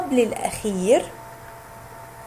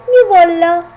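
proud reveal; 4 semitones higher, 7 dB louder, slower than usual. Shown as a demonstration. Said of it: "يد تمسك في الميم".

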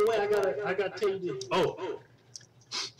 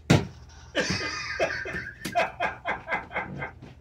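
wheezing laughter; high breathy laugh. Real, not acted.

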